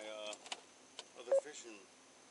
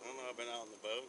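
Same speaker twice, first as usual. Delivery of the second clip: slurred speech; 10 semitones lower, 12 dB quieter, faster than usual. Real, not acted. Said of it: "You're slurring, you're slurring your words".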